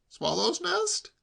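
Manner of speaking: high, drawn-out rising question; incredulous nephew. A narrator's reading. Nephew doubting uncle's excuse, incredulous. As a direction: echo it high in disbelief.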